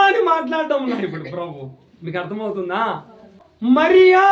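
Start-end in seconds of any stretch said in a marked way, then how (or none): 3.8-4.2 loud, higher-pitched call; raised voice, imitating a call; calling by name. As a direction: call her name out strongly. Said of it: "అని పిలుస్తున్నారు".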